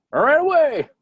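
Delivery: high, loud mock panic. Fleeing a crazy show, high loud mock panic. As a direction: yelp it like fleeing. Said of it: "mock cry".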